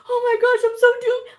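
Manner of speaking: mocking an overdramatic person; mock panic. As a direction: shriek it like a melodramatic wreck. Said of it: "When you see somebody".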